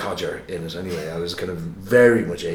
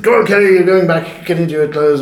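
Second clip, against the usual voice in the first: much higher and louder priestly command; stern order; 9 semitones higher, 9 dB louder than usual. Retold or imitated. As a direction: order the boy back, firmly. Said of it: "And he said".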